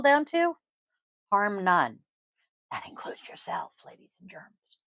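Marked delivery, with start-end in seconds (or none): 2.7-4.8 whispered playful aside; whispering. Real, not acted. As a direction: whisper it as a wink. Playful aside after sermon.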